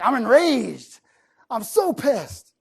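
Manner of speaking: loud, drawn-out mock fury; really upset. Speaker showing words used when upset, hammed-up outrage. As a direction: punch each word high and hard, hammed-up. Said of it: "when you're really upset".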